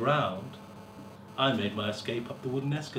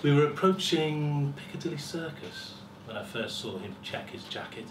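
ordinary delivery

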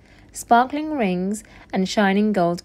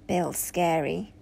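very soft, slow, low private thought; thinking to oneself; 4 semitones lower, 9 dB quieter, slower than usual. A narrator's reading. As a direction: think it aloud, hushed and uneasy. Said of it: "Oliver thought".

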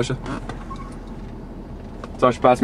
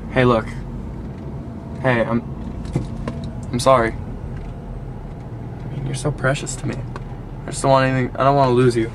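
tender apology; 5 semitones lower, 7 dB quieter than usual. Acted. Making up with the dog.

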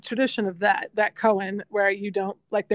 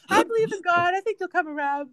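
mom voice, high; 10 semitones higher than usual. Retold or imitated. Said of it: "you know".

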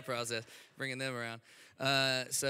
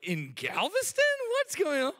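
high, loud incredulous mock disbelief; amazed in-laws; 6 dB louder than usual. Retelling his wife's Texas family, high shocked disbelief. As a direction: imitate small-town family in shocked disbelief. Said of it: "And they were like".

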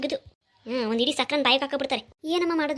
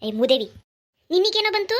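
loud scolding that leaps up high; angry scolding; 5 semitones higher, 5 dB louder than usual. Acted. Mother scolding the son who wants to leave, angry. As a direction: lash out in loud, indignant disbelief.

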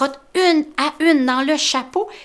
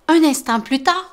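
plain reading voice

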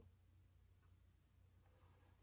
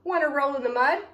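high, loud, quick storybook pig; pig's invitation; 4 semitones higher, 20 dB louder than usual. A narrator's reading. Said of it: "grunted the pig".

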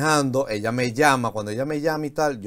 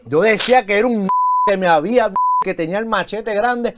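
higher and louder, laughing as he insists; laughing insistence; 7 semitones higher, 5 dB louder than usual. Real, not acted. Guest insisting to host it's true, laughing. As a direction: swear it's true while still cracking up. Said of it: "esto no es mentira".

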